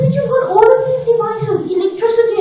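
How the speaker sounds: high-pitched mock motherly nagging; mommy talk. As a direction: imitate a mother listing her complaints. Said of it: "you know the usual mommy talk".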